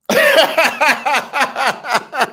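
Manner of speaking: a high burst of laughter. Real, not acted. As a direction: laugh helplessly. Laughing at the daughter.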